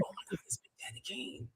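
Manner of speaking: breathless hushed whisper; starstruck whisper. Retold or imitated. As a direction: whisper it in awe. Retelling starstruck reaction.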